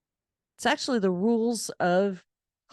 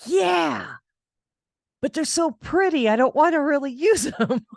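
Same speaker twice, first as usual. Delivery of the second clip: much higher, louder coy protest; coy high whine about marbles, laugh at the end; girlish protest; 10 semitones higher, 5 dB louder than usual. Retold or imitated. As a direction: plead that they're too pretty. Protesting marbles too pretty.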